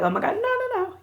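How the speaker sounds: high-pitched, horrified pleading, hammed up; mock panic. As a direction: imitate a panicking crowd, voice shooting up high. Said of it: "they're all looking like".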